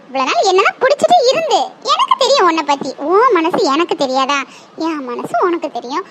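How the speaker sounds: ordinary talk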